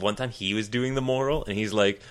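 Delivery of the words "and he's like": ordinary talk, nothing marked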